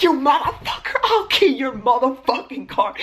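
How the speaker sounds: high, laughing mock screaming threat; retelling how she went off on the driver, high laughing mock fury; mock rage. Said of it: "I was fucking going off".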